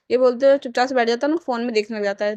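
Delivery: usual speaking voice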